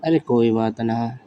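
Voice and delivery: normal talking voice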